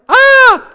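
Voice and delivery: high, loud startled scream; sudden high scream of fright; scream at a cockroach. Shown as a demonstration. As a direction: shriek like you just saw a cockroach. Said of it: "Cuando ves una cucaracha".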